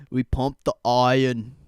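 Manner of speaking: second go at a half-hearted Arnold; Arnold impression. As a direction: punch iron like a mock bodybuilder. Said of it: "That was awful".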